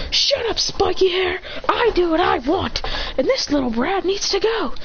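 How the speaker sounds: high-pitched irritated snapping; defiant retort. Acted. Bully snaps back in quarrel.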